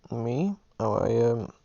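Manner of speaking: taken by surprise, fumbling for words; flustered burst when suddenly asked; caught off guard. Real, not acted.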